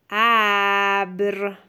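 ابر with a long vowel and held r; sounds stretched. Shown as a demonstration. Teacher dictates the first word to a pupil, very drawn-out. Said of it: "صدا کشی کن و بنویس".

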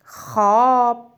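slow, clear single word; slow dictation. Shown as a demonstration. Teacher dictating a word to a pupil. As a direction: dictate it slowly. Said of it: "به ذهنت بسپار".

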